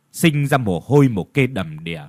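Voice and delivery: plain reading voice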